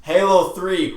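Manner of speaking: normal talking voice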